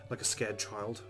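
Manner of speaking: ordinary talk, nothing marked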